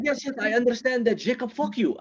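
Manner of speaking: put-on high-pitched, insistent foreign accent; worked-up accented friend; Ahmed. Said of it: "like, a passionate friend".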